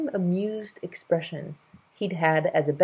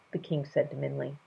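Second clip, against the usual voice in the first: ordinary delivery, nothing marked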